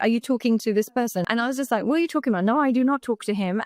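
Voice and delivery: usual speaking voice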